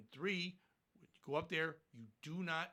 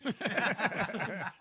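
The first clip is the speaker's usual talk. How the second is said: laughing; 4 semitones higher, 10 dB louder than usual. Real, not acted. Laughing at his own joke.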